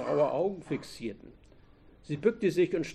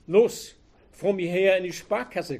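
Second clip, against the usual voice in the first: barked order, higher and much louder; commanding; 5 semitones higher, 8 dB louder than usual. A narrator's reading. Robber ordering the hostage into the bank, commanding shout. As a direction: drive her forward with a harsh command. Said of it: "kommandierte er".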